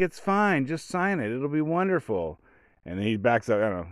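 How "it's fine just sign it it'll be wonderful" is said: high, sweet talk-him-down voice; conciliatory coaxing. Retold or imitated. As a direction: coax him gently like he's a child. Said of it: "conciliatory tone of voice".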